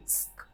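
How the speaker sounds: स्क as a tight cluster of s and k, slow; स्क said slowly, with no vowel between s and k; half s and k. Shown as a demonstration. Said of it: "ऐसा आधा स और क".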